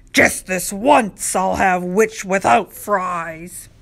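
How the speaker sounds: slow, mean dragon voice; menacing dragon. A narrator's reading. Dragon closes in to eat the witch, slow menace. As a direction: draw it out like a hungry dragon.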